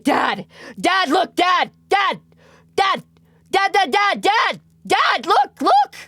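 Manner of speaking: put-on high, loud excited child's shouting; imitating kid yelling. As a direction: call for dad like a thrilled little kid. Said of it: "he was yelling for Zolon".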